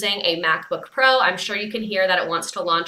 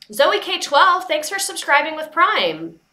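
much higher and louder, a bright shout-out; excited thanks; 9 semitones higher, 5 dB louder, slower than usual. Real, not acted. Streamer thanking a viewer for a new subscription, excited. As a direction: call out the name with a burst of delight.

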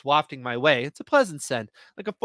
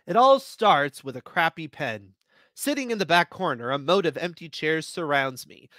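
usual speaking voice

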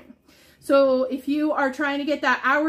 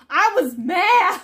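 high, loud laughing "I was mad"; laughing mock anger; 6 semitones higher, 6 dB louder, slower than usual. Real, not acted. Seller laughing to viewers about missing the restock. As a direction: play up being mad through a laugh.

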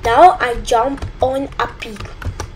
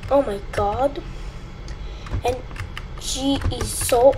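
ordinary talk, nothing marked

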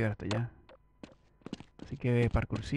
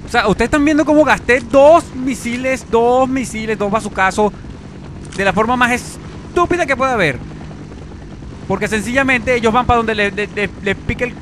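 higher, louder exasperated tirade; angry rant; 10 semitones higher, 9 dB louder than usual. Real, not acted. Gamer ranting to viewers about stupid missiles. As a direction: complain bitterly to the viewers.

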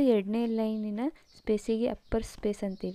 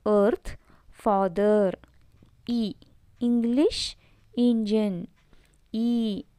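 ordinary delivery

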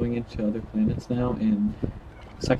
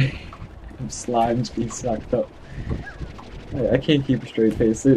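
ordinary talk, nothing marked

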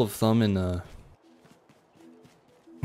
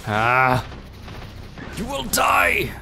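battle shout; 3 semitones higher, much slower than usual. Acted. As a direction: roar it at the enemy. Fighting harpies in game.

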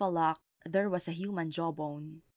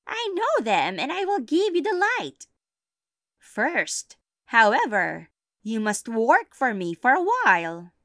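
witch voice; 5 semitones higher, 8 dB louder than usual. Acted. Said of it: "said the witch".

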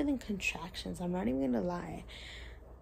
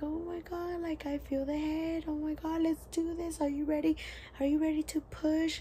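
excited doctor; 7 semitones higher than usual. Retold or imitated.

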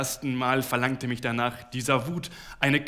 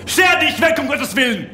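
much higher, louder yell; furious shout; 12 semitones higher, 11 dB louder than usual. A narrator's reading. Husband yelling at his wife to get out, furious shout. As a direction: drive her out with a furious shout.

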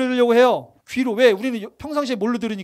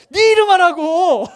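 playful shout; 6 semitones higher, 7 dB louder than usual. Real, not acted. Teasing congregant, playful scolding.

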